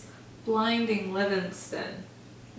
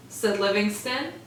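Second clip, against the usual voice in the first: plain reading voice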